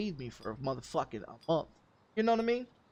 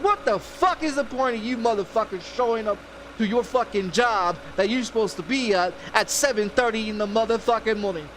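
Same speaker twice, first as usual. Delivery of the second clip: loud, higher-pitched chewing-out; heated replay of a phone tirade; retold angry rant; 5 semitones higher, 6 dB louder than usual. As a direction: tear into them for slacking, loud. Retelling his phone tirade.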